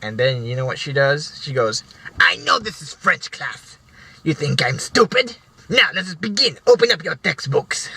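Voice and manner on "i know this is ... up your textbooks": haughty teacher. Retold or imitated. Teacher refusing to admit mistake to class, haughty and higher. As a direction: brush off the mistake with lofty authority.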